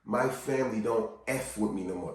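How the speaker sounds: low, soft confession; subdued acted hurt; downcast admission. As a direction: admit rejection quietly. Voicing someone's painful admission.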